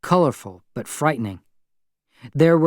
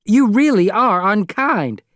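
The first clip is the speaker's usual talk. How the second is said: slow, loud, higher accusation; angry reproach; 9 semitones higher, 5 dB louder than usual. Acted. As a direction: spell out the blame slowly. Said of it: "I said angrily".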